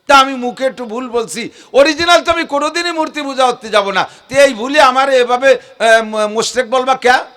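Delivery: imitated objector. Preacher mimics a worshipper excusing the error, lightly whiny. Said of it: "এবার হয়তো আপনি বলবেন".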